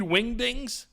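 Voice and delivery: "Wingdings" punched up high; emphatic. Real, not acted. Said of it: "Why am I saying it like that?".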